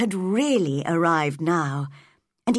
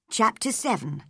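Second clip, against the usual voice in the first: plain reading voice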